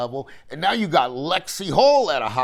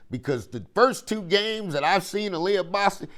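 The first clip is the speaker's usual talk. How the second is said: ordinary talk, nothing marked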